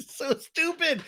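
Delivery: high, squeaky helpless laughter; laughing. Real, not acted. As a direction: crack up and squeeze the words out. Cracks up at own gulp imitation.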